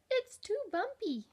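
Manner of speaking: high, loud little-bug voice; the ladybug whining to the kangaroo, high and squeaky. A narrator's reading. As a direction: complain in a tiny squeaky ladybug voice. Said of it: "cried the lazy ladybug".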